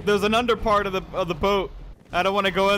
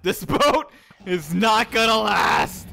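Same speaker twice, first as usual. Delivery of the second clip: drawn out, laughing at the disaster; slow, amused warning of doom; doomed declaration; 2 semitones higher, much slower than usual. Real, not acted. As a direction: stretch each word in comic alarm.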